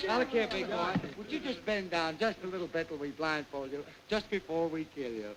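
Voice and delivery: ordinary talk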